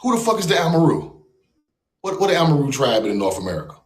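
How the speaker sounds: usual speaking voice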